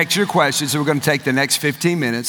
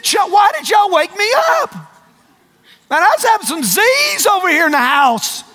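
mock grumble at being woken; high, shouted comic complaint; Jesus; 4 dB louder than usual. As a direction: play a put-out sleeper, hammed up.

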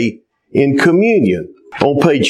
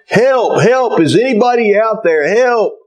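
crying out for help; 7 semitones higher than usual. Acted. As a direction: call out from the cliff as if no one can hear. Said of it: "he began to cry out for help".

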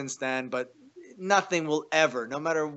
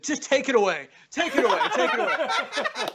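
laughing take it away; 10 semitones higher, 5 dB louder than usual. Retold or imitated. Host orders the rooster away.

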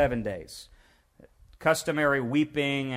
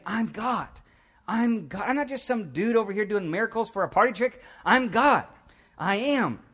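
emphatic, high-pitched declaration of divinity; Jesus; 8 semitones higher than usual. Acted. Preacher voices Jesus correcting those who doubt him, indignant proclamation. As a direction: insist on it, pitch raised, punching the last claim. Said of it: "That's what he's saying".

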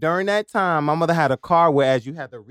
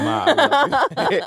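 higher, louder, slow laughing apology; laughing aside; 11 semitones higher, 8 dB louder than usual. Real, not acted. Son apologising to his mother mid-story, laughing. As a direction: laugh it out affectionately. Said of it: "I ain't gonna give her accent".

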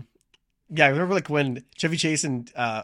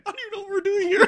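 very high, quick panicky squeal; hammed-up falsetto panic tipping into laughter; mock fear; 3 dB louder, faster than usual. Imitating a frightened Martin Short, mock panic. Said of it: "His fear is, like, palpable".

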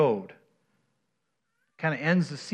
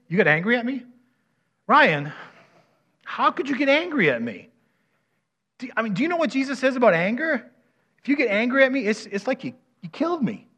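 high-pitched, playacted wounded protest; mock hurt; 7 semitones higher than usual. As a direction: confront them in pretend disbelief, pitched high. Role-plays confronting Ryan over anger.

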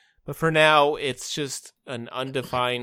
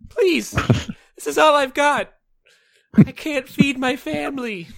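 pleading shopkeeper; 12 semitones higher, 7 dB louder than usual. Pretend. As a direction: beg for mercy, voice breaking. Shop owner begs extortionist.

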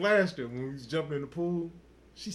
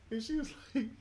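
normal talking voice

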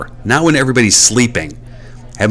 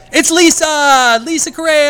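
excited greeting. Real, not acted. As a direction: call her name out with delight.

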